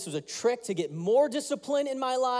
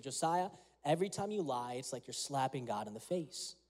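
tender lesson; 6 semitones lower, 8 dB quieter than usual. Retold or imitated. Dad's lesson told gently.

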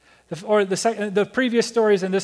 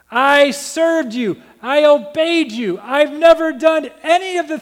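higher, louder indignant complaint; ticked off; 7 semitones higher, 5 dB louder than usual. Retold or imitated. Older son confronting his father, loud and indignant. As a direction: hurl the grievance at his father. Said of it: "this son stands before him now ticked off".

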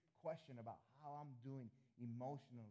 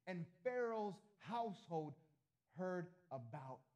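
"So loudly" stretched slow and louder; dramatic scripture reading; 2 semitones higher, 4 dB louder, slower than usual. Acted. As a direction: read the verse out with weight.

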